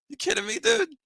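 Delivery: playing the Mariners, squeaky disbelief at the offer; mock disbelief. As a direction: scoff at the ridiculous offer in a high mock voice.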